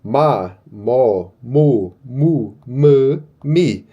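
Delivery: ma, mo, mu, mi each said clearly and slowly; reading syllables aloud. Shown as a demonstration. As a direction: sound out each syllable like a drill. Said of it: "as vogais, que nem a gente vai ler aqui". (sílabas ditas devagar, uma a uma)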